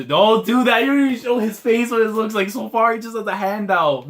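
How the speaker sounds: high, loud fan protest; distressed pleading. Real, not acted. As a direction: cry out as if you can't take it. Begs the page not to continue.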